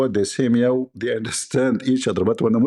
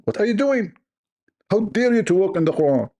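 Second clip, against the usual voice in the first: mock outrage; 8 semitones higher than usual. Scolding cat for walking on Quran.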